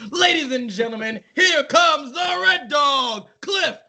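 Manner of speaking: booming ring announcer; grand entrance announcement. Pretend. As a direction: stretch it out for the crowd. Announcing tag partner entrance.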